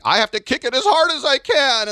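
high loud panicked thought; panicking penalty taker. Retold or imitated. Nervous penalty taker psyching himself up, high and panicky. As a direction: squeak it in panic. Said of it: "they think".

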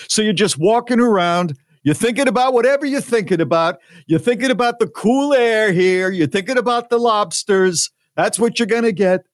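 high, pushy Brooklyn woman; thick Brooklyn accent. Retold or imitated. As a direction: confront a stranger in thick Brooklyn. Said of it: "she's got this thick Brooklyn accent".

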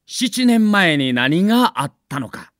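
usual speaking voice

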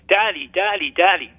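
imitating a frightened little boy's call, not full-voiced; retold child's cry. Boy calling his father after a nightmare, retold shout. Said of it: "I remember shouting out and crying".

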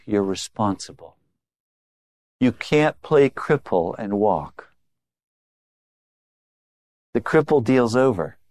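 normal talking voice

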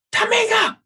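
high yell of the name, carrying across the gym; shouted name. Retold or imitated. Coach yelling the player's name across practice, a high shout. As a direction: call her out sharply from across the court.

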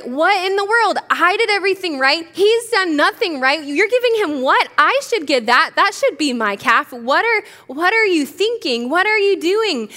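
acted high-pitched sibling outburst; pouty tantrum. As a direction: protest indignantly, like a sulking brother mid-tantrum. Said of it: "throwing a full-blown tantrum".

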